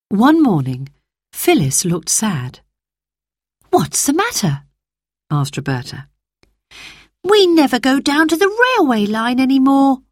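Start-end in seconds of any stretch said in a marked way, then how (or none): 3.7-4.6 put-on child voice. Child voice in read story. 7.2-10.0 put-on young girl voice. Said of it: "replied Phyllis".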